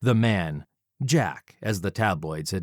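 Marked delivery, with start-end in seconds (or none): none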